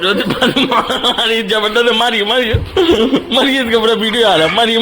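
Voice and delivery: egging on, high and loud; excited hype; excited cheering. Real, not acted. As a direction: cheer the hit on. Cheering a roll being hit.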